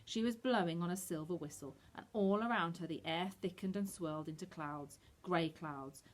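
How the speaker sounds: usual speaking voice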